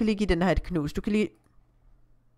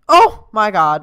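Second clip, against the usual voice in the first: sudden loud exclamation; startled; 11 dB louder, slower than usual. Real, not acted. Startled mid-sentence by the game. (pludseligt forskrækket udbrud)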